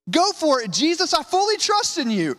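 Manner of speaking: a cheerful hammed-up show of faith; mock confident reply. Shown as a demonstration. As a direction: chirp it like an overeager believer. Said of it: "No, what's he say?".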